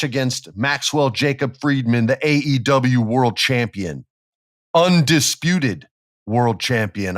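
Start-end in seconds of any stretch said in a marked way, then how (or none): 4.7-5.9 undisputed drawn out slow and louder, higher; slow emphasis. Shown as a demonstration. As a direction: lean hard on undisputed, slowly. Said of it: "I might add".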